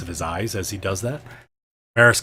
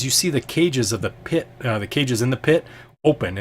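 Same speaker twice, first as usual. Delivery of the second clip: normal talking voice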